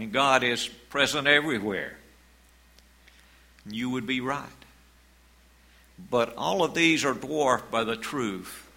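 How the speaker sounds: usual speaking voice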